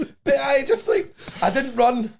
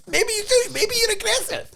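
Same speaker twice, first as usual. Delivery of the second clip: very high, squeaky-pitched mocking protest; mock indignant retort. Comic answering the footballer who blames his accent, mock indignant. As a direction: push it way up in laughing disbelief.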